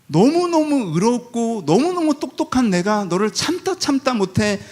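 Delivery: smug self-righteous. Retold or imitated. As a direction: drip with superior patience. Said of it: "감정이".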